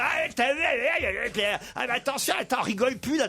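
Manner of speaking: half-hearted alarm, stop laughing now; mock warning.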